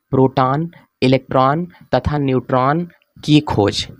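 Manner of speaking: slow, spaced-out heading; slow dictation. Real, not acted. Teacher reads out the heading for the class, slow dictation. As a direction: dictate it piece by piece with pauses.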